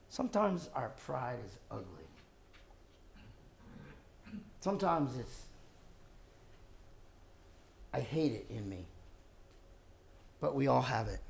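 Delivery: soft, low confiding voice; hushed sermon. Real, not acted. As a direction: draw them in quietly, low and soft. Quiet intimate sermon passage.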